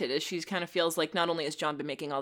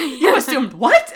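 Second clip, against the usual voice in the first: loud, high, drawn-out mock outrage; slow incredulous question; shocked disbelief; 6 semitones higher, 8 dB louder, slower than usual. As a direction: imitate being utterly blindsided. Retold shock at bad news.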